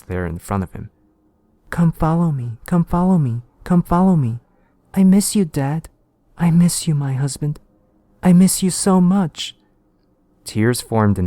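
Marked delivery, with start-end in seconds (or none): 1.7-9.6 high, loud eerie calling of the dead; ghostly family voice. Acted. Dead wife and child calling the detective, high and eerie. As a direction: lure him in a high ghostly voice.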